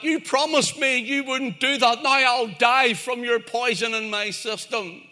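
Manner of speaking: high-pitched, hurt pleading; acted dismay, pitched well up; betrayed protest. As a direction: plead as the wronged frog of a fable. Stung, betrayed frog pleads while dying.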